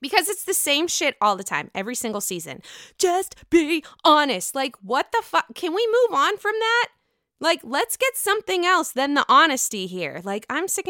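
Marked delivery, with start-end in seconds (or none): 3.0-4.5 slow, loud, higher mock plea; mocking refrain. Host mocks the same line every season, mocking refrain. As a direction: drag out each word in mockery.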